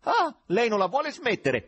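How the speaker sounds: loud, high, enraged shout; furious yell. A narrator's reading. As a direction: yell at the caller in fury. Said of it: "urlò l'amministratore infuriato". (urlo infuriato)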